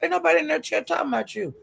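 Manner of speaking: calling her out. Retold or imitated. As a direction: shut down the lie with rising heat. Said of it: "She said".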